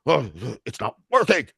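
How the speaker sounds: high, fast, flustered imitation of an impatient person; mock flustered person.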